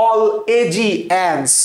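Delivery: "all এ জি এনস" slow and syllable by syllable; drawn-out memory cue "all, এ, জি, এনস"; broken-up pronunciation. Shown as a demonstration. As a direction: drill "all এ জি এনস" slowly, each piece distinct. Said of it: "কি পড়াইছিল?".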